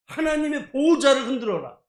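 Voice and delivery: loud, high-pitched battle cry; rousing slogan. Retold or imitated. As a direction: proclaim it loudly like a rallying call. Proclaims a book title as slogan.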